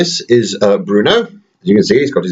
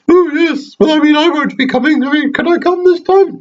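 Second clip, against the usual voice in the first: high squeaky toy voice, raised and bright; teddy voice. Pretend. Dad voicing teddy Toronto to his baby son, high toy voice. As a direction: squeak it out as the little bear.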